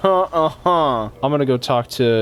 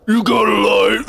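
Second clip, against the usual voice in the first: higher, louder hammed-up smoker; smoker Patrick; 7 semitones higher, 3 dB louder than usual. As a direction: shout it as a cartoon smoker. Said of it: "Patrick would sound like if he was a smoker".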